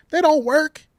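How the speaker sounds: sudden high, loud jab; indignant outburst. Real, not acted. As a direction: spike it up in outrage. Indignant rant.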